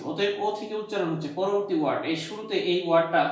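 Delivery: normal talking voice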